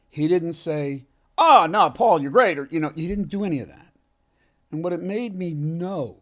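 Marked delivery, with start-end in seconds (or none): 1.4-2.6 loud, higher, over-the-top reassurance; mock gushing praise. Imitating the flattering praise Jason never gave. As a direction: fake it, loud and bright-eyed.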